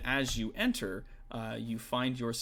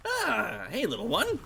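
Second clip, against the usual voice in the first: high, hearty big-woman voice; orc woman greeting; 12 semitones higher, 3 dB louder than usual. Acted. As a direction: tower over the little one and greet her. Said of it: "She looks down over to you and goes".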